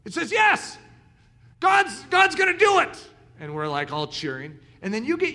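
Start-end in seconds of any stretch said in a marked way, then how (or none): 0.0-3.1 high, loud joyful outburst; excited shout. Retold or imitated. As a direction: burst out with delight at the yes. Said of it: "one of you in here goes".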